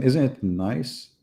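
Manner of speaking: normal talking voice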